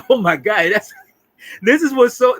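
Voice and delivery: usual speaking voice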